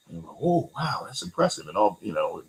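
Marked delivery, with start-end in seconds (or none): 0.4-1.7 higher, softer mock admiration; impressed reaction. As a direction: imitate someone impressed by a neighbor. Acting out impressed people meeting neighbor.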